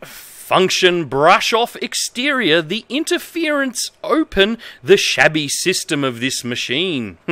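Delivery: reads broken manual text in a lightly formal voice; straight-faced reading of nonsense instructions; mock-earnest manual reading. Reads bad manual translation aloud.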